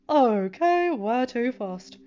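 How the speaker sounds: loud then slow, lightly voiced; lightly voiced team leader checking; Steve. A narrator's reading. Steve asks the kids where next, loud then slow.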